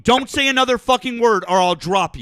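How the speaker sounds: loud yell with voice cracking. Real, not acted. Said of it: "Your voice cracked".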